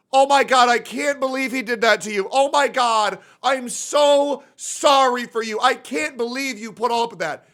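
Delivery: high gushing mock sympathy; mock internet sympathizers. Cohost mocks strangers online, high gushing mock sympathy. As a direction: coo over him like a gullible commenter. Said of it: "go on the internet and say".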